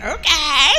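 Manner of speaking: loud, high, rising okay; hammed-up chirp of agreement; acted eager okay. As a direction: call it out high and loud, playfully. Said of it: "So I'm like".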